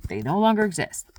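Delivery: ordinary talk, nothing marked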